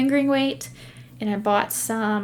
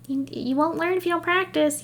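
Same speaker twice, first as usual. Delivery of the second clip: mother's voice; 7 semitones higher than usual. Retold or imitated. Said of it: "my mother's voice in my head".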